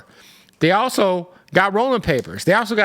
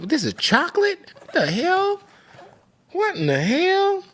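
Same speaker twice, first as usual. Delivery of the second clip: much higher, stunned disbelief; shocked; 14 semitones higher than usual. Real, not acted. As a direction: squeak it out in surprise. Surprised tasting a flavor.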